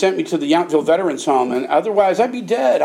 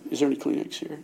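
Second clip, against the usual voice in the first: mock teary; 5 semitones lower, 11 dB quieter, faster than usual. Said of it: "I'm kidding".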